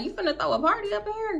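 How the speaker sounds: high, fast mock-incredulous question; teasing disbelief. Teasing her roommate about throwing a party, high and fast. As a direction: needle her, playing shocked.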